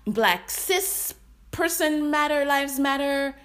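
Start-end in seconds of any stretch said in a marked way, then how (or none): none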